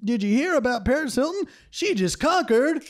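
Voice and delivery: high, excited gossip voice; airhead gossip. Retold or imitated. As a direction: play a clueless gossip spreading fake news. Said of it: "being like".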